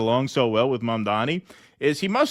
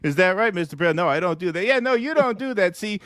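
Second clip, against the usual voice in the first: higher, bragging Trump-style rambling; Donald Trump voice; 7 semitones higher than usual. Acted. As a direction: play Trump chatting up a guest, pleased with himself. Trump voice teasing guest.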